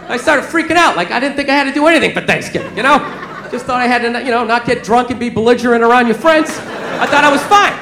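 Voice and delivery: ordinary talk, nothing marked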